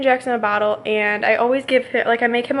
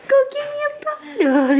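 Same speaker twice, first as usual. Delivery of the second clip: put-on much higher, crying baby whine; squeaky baby voice. Mom voicing baby Jackson wailing for his bottle.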